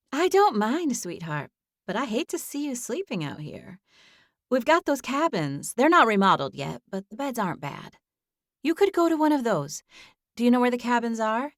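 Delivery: a caring neighbour lady; kind older woman voice. A narrator's reading. Amanda's voice held.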